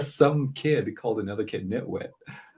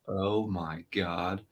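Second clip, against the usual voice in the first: slow, softer disbelief; hushed dismay; 3 semitones lower, 7 dB quieter, much slower than usual. Retold or imitated. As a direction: draw it out quietly, appalled. Retelling his dismayed reaction.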